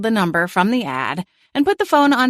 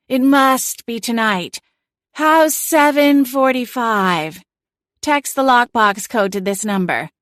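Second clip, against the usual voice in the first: slow, dreamy put-on sales voice, higher; a cat playing a languid real estate agent; airy, sedated realtor voice; 5 semitones higher than usual. A narrator's reading. Celine fakes an airy realtor voice to the homeowner on speakerphone. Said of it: "I'll put on my real estate voice".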